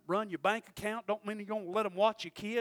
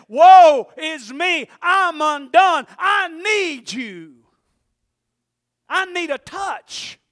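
drawn-out wail, much louder and higher, very slow; anguished plea; 7 semitones higher, 17 dB louder, much slower than usual. Acted. Isaiah crying out before God, slow anguished wail. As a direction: stretch every word like a man undone. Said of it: "He said".

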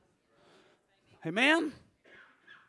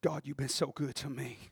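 much softer and lower, hushed; David alone with God, hushed; quiet prayer; 12 semitones lower, 19 dB quieter than usual. Acted. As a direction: pray alone, barely above a breath. David praying alone, surrounded.

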